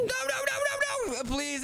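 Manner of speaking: high, crying shouted nos; frightened pleading. Acted. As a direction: beg in panic, near tears. Begging the angry elf.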